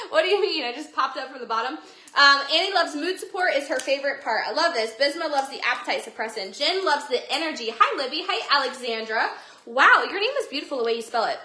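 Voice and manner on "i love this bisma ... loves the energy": usual speaking voice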